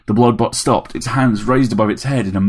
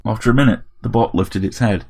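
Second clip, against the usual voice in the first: ordinary delivery, nothing marked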